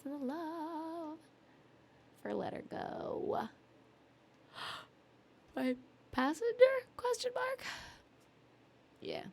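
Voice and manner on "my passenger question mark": high, gasping surprise; surprised. Real, not acted. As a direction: gasp and ask in disbelief. Gasps while reading chat.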